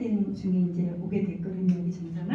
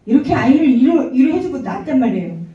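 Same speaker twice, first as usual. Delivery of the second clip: emphatic; 4 semitones higher, 11 dB louder than usual. Real, not acted. Emphatic testimony telling.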